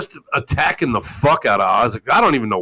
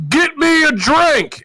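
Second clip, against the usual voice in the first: much higher, slow, bossy yell; mock demanding yell; 4 dB louder, slower than usual. Host mocking a lazy man ordering his family, higher bossy yell. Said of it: "he'll be like".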